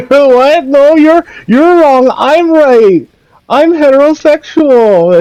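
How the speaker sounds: high, loud, whiny mock voice; hammed-up high defensive whine; mocking fan voice. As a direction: squeal back like an offended fanboy. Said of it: "They're like".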